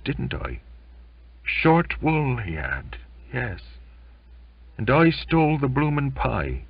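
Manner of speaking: choked, halting grief for a dead shipmate; grieving sailor. Acted. Belfast grieves to the narrator about Jimmy, tearful and halting. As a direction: hold back tears, then break.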